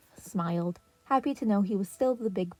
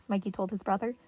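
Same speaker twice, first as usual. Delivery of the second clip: ordinary delivery, nothing marked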